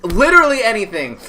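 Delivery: high, quick, through laughter; real giggly exasperation; laughing self-reproach. Real, not acted. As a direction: blurt it out while cracking up. Cracking up over own blunder.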